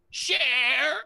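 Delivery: high rising creature croak, calling share; dragon voice. Acted. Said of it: "and says".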